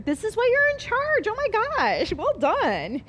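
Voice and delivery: thrilled that Meg beat her answer; delighted praise. Real, not acted. Chef thrilled by assistant Meg's answer, excited praise.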